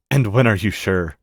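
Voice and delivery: plain reading voice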